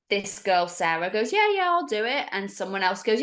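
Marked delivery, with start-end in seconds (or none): 1.3-2.3 high-pitched keen volunteering; eager. Retold or imitated. Sarah volunteering for the Ouija board, eager. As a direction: jump at the offer, imitated.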